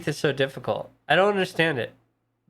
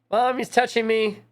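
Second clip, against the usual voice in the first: whining to mom about a sibling; a kid tattling in a whiny pitch up; whiny tattling little sibling; 7 semitones higher than usual. Retold or imitated. Kids tattling quoted.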